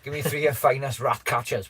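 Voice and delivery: ordinary talk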